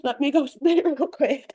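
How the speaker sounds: talking around a toothbrush; while brushing teeth. Real, not acted. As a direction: mouth full of foam. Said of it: "I'm in the middle of brushing my teeth".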